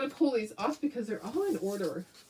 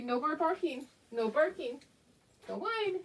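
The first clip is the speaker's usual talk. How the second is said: higher, softer sing-song telling-off; gentle pet scolding; 7 semitones higher, 4 dB quieter than usual. Real, not acted. Owner gently scolds the dog as she puts her out. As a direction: scold the puppy sweetly.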